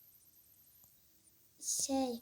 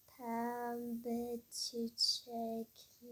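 very slow, soft, drawn-out shopkeeper; painfully slow speech; 2 semitones lower, 8 dB quieter, much slower than usual. Acted. Florist repeats the customers' order, painfully slowly. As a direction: drag every syllable out, in no hurry. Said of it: "hızlı konuşur musunuz?".

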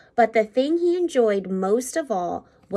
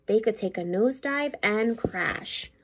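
ordinary delivery

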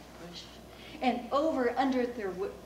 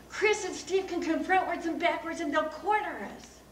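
put-on high, urgent kid's explanation; raised, pressing voice; urgent warning; 7 semitones higher, 3 dB louder than usual. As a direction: warn the gang mid-chase. Child urgently warns friends while fleeing.

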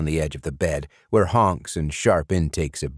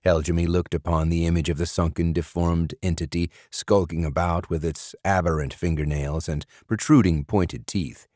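ordinary delivery, nothing marked

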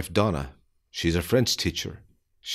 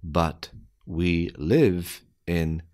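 slow, deliberate, word by word; careful slowed-down reading; slow dictation; slower than usual. Shown as a demonstration. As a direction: spell it out slowly for beginners. Slow repeat for learners.

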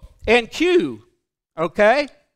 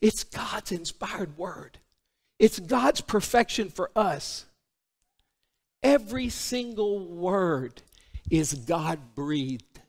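softer, slow, weighted declaration; reverent preaching; 5 semitones lower, 7 dB quieter, slower than usual. Real, not acted. Preacher honouring God's inspired word, reverent. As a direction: let each phrase settle reverently.

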